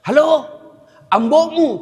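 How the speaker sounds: loud calls of halo down the line; shouting into the phone. Retold or imitated. Old man shouting into a payphone at his son. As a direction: yell into the phone like an old man who can't hear. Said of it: "Sudah teriak".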